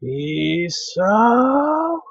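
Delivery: drawn-out stern call, rising in pitch; stern woman's elongated call; gruff stern female voice. Acted. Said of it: "Stern female voice gruffly calls out".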